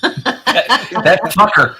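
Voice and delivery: slow words broken by laughing; helplessly amused, laughing through the words; real laughter. Real, not acted. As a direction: crack up while talking, high and loud. Amused at played clip.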